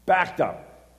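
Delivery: high, loud frustrated repeat; exasperated outburst. Retold or imitated. Stuck in traffic, venting to the congregation, exasperated loud. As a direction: throw it up in disbelief.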